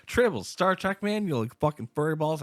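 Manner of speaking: put-on hyped teen pushing trivia on a classmate, loud and fast; going crazy. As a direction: gush it out like a manic fan. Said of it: "I'm just, like, going crazy about it".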